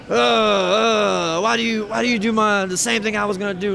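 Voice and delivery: hammed-up outrage dissolving into blather; mock furious rant. Wrestling partner complaining someone copied his brawl, mock furious. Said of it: "ready to kill all of us".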